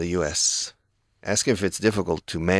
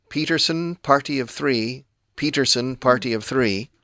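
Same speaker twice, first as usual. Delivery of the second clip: raised, sing-song call of a name over a speaker; intercom announcement; 6 semitones higher than usual. Retold or imitated. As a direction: call out the waiting party like a hostess on the intercom. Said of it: "over the intercom, they say".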